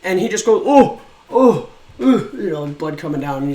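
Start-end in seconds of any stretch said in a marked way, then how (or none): 0.7-2.0 high, loud imitated yelp of pain; louder, higher hurt oh oh; mock pained yelp. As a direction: act out the staffer's startled cry. Staff member hit on the nose.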